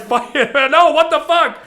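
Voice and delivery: hammed-up outrage; mock angry yell. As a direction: play the offended guy shouting no. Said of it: "they'll get mad".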